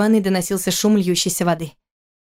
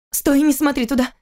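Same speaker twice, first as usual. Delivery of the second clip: panicked cry; 7 semitones higher than usual. Acted. Wife panics, stops husband.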